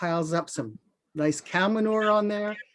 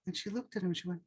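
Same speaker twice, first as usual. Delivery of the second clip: plain reading voice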